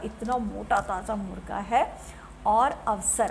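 ordinary talk, nothing marked

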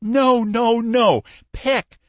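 high, loud correction; exasperated. A narrator's reading. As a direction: cry out in frustration at being misheard. Frustrated caller corrects misheard name.

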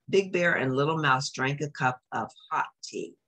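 ordinary delivery, nothing marked

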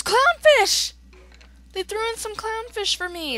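high, loud delighted exclamation; excited discovery. Real, not acted. Gamer spots a favorite item in the chest, excited. Excited find of clownfish in game.

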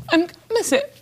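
choked up, voice breaking high; fighting back tears; crying. Real, not acted. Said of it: "don't cry, don't cry".

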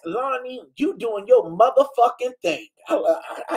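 usual speaking voice

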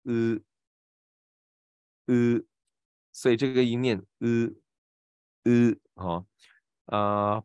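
呃 as the buzzing vowel split off from 滋; zi vowel isolated. Shown as a demonstration. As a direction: sustain 呃 as the i of zi, tongue flat. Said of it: "Z I滋就是念呃".

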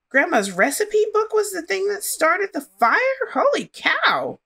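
high, loud disbelief; shocked. Real, not acted. Player learns the recipe book started the fire, shocked. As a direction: gasp it out in shock.

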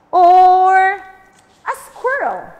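loud, slow, drawn-out question; playful quiz. Real, not acted. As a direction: tease out the answer. Quiz to children.

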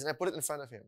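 ordinary delivery

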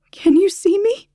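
high, breathless whispered question; panicked whisper. Acted.

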